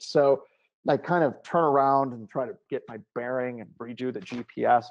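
usual speaking voice

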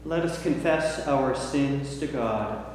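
ordinary delivery

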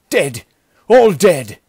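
loud, high cry of horror; horrified cry. A narrator's reading. Wounded bandit to his chief, crying out in horror. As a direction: cry out the dreadful news. Wounded survivor reports massacre.

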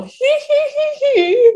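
high, loud imitated nervous tittering; mock her own nervous giggles. Client mimicking her earlier nervous giggle, high titter.

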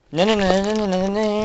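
high, loud string of noes; panicked noes. Real, not acted. Kid discovering the missing card, high and loud in panic. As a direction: cry out no over and over in alarm.

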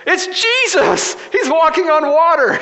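very high, loud, drawn-out holler; mock amazed yell. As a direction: imitate a tipsy man yelling in astonishment. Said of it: "And the other guy goes".

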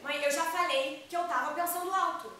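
put-on fed-up teenage protest at mother; exasperated. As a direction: raise your voice in irritated self-defence. Daughter snaps back at mother.

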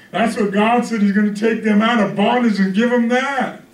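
preacher carried away by abundance; impassioned preaching. Real, not acted. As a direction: pile it on with rising fervor. Preaches at full force.

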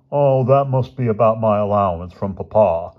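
put-on loud, affected rich-boy voice; affected George, pleased. Rich boy expecting money from his father, affected and pleased. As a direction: put on a self-important upper-crust boy.

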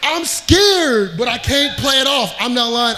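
normal talking voice

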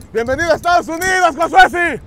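high, loud celebratory announcement; shouted welcome. Real, not acted. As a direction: hail your friend's arrival at full voice. Shouted welcome across the border. (bienvenida a gritos, eufórica)

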